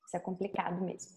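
soft unvoiced whisper. Real, not acted. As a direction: breathe it out as a quiet aside. Host's aside while asking questions.

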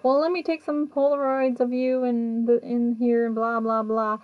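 high, sing-along mock quote of a colleague; Aaron. Investigator offers to take Polaroids of the spirit, high imitation. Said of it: "Aaron's like".

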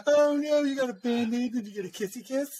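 put-on high-pitched babying coo; mock baby talk. Host teases friend about his injury, mock baby talk. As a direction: fuss over them like a hurt toddler.

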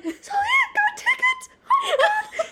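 very high laughing scream. Retold or imitated. Telling her roommate the news, screaming with joy. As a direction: shriek it out with joy. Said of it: "hears me screaming".

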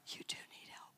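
whispered aside, no voice at all; a hushed, teasing whisper. Real, not acted. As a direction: lean in and whisper it to the room. Aside to the congregation.

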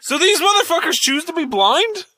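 high-pitched, disbelieving question; incredulous. Real, not acted. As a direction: blurt it out in comic disbelief, pitch way up. Stunned by the absurd answer.

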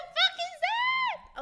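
very high squealed disbelief; mock horrified shriek. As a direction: shriek it as if appalled by your own recording. Retold shock hearing own accent.

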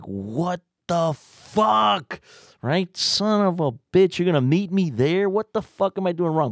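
high, drawn-out "what the fuck" then ranting; indignant self-talk, high and slow; exasperated disbelief. Retold or imitated. Frustrated inner monologue.

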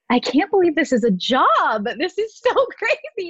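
high, giddy reenacted disbelief with a laugh; gushing retold wonder; amazed excitement. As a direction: marvel at it, voice climbing. Retold amazement holding fake blood.

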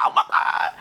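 breathless whisper; hushed flustered gasp; whispered oh my God. Retold or imitated. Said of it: "I would actually be like".

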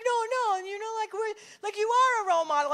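high, loud imitation of panicked kids; frantic reassurance. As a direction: hurriedly talk her back up, high-pitched.

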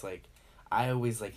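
ordinary delivery

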